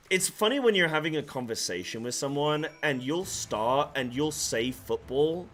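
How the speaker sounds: ordinary delivery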